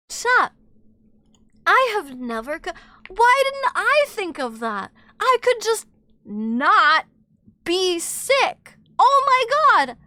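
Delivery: high, loud, hammed-up revelation; mock astonishment. Streamer told to just not be sick, hammed-up outrage. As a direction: exclaim as if your mind is blown.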